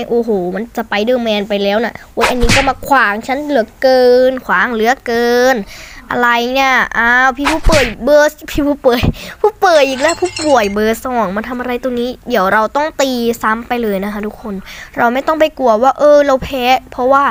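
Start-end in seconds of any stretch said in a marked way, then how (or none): none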